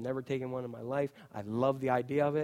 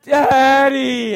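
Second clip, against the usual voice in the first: put-on excited child shout; 11 semitones higher, 18 dB louder than usual. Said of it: "I've got this major".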